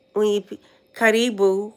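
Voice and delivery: normal talking voice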